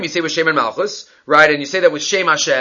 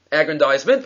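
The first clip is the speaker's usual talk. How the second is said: ordinary delivery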